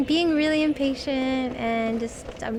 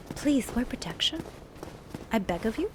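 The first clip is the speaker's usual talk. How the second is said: soft, hushed begging; very quiet, whispered mock entreaty; mock pleading whisper; 4 semitones lower, 9 dB quieter than usual. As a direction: whisper a playful plea. Joking plea to viewer, hushed.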